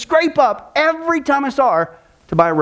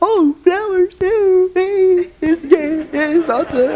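high, thrilled gushing over flowers; wife gushing over the flowers; delighted wife; 7 semitones higher, much slower than usual. Retold or imitated. Wife thrilled by her husband's flowers, high and gushing.

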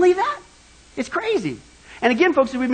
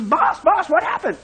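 higher-pitched acted urgent call; mock alarm; 6 semitones higher than usual. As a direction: call out to the stricken boss, lightly alarmed. Bubba finds boss after heart attack.

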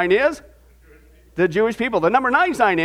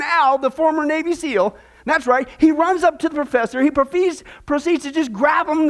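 normal talking voice

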